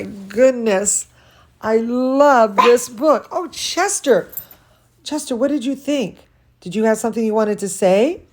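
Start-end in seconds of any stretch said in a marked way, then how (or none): none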